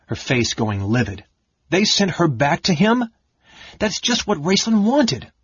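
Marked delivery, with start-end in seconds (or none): 1.7-5.3 high, incredulous fury; rising outrage at the news; outraged. A narrator's reading. Kitiara furious that the cleric was sent back.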